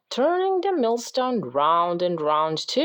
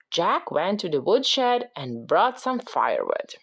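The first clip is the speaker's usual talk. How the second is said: ordinary delivery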